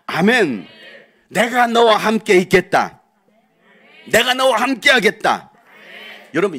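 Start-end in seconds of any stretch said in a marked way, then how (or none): 1.3-3.0 loud, slow proclamation of God's word; booming divine promise. Acted. Preacher proclaiming God's promise to the congregation, booming. 4.1-5.5 loud declaration of God's word; booming divine promise. Acted. Preacher repeating God's promise to the congregation, booming.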